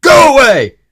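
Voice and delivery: very loud, high yell; angry shout. Acted. Mister Grumpy shouts Mister Happy out of his garden. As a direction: bellow him off the property. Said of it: "snapped Mister Grumpy".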